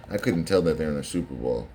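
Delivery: ordinary delivery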